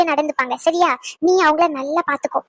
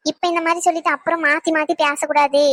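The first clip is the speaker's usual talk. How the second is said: ordinary delivery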